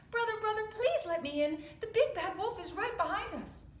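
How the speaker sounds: high, loud, breathless begging; panicked pig. Acted. Little pig begging his brother to open up, panicked. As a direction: plead frantically at the door.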